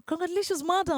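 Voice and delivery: high, bright-pitched mock congratulations; a doctor announcing a pregnancy, pitched high; doctor's cheer. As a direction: sing out the good news like a cheery doctor. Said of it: "you know how doctors come with the news".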